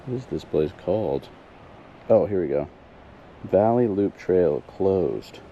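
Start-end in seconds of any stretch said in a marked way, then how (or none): none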